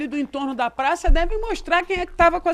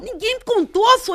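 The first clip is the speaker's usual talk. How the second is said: mocking whine; 9 semitones higher, 4 dB louder than usual. Mocking Zema's excuse.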